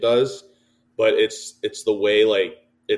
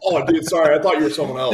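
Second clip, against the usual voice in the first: higher, louder fake apology; mock sheepish apology; 6 semitones higher, 4 dB louder than usual. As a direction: play at backing down, sheepishly. Mock apology to the big guy.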